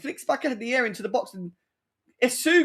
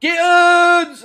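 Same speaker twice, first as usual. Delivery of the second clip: loud, higher yell of the player's name; excited shout; 9 semitones higher, 10 dB louder than usual. Real, not acted. Fan yelling the player's name loud as he shoots. Shot on goal live.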